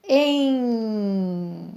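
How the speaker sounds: "Em" said with a nasal vowel. Shown as a demonstration. Teacher showing pupils the nasal sound of "Em". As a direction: let "Em" ring through the nose. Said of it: "O E com som nasal".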